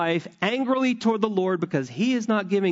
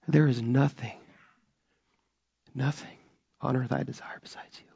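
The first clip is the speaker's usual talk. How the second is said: the words "nothing on earth" fall to a near-broken quiet; real emotion, quiet and held back; emotional hush; 3 semitones lower, 4 dB quieter than usual. Real, not acted. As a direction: let the feeling choke the line down, softer. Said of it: "Do you know why I'm emotional about this?".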